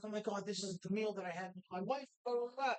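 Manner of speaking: ordinary delivery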